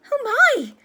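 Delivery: very high, loud exclamation; shocked cry. A narrator's reading. Hermione seeing the slashed portrait, shocked cry. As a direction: cry out in horror.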